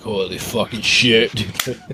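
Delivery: low, slow mock amazement; a touch of stunned disbelief, drawn out; mock awe. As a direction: play a spooked cop, half under your breath. Retold cop's overreaction to a whisper.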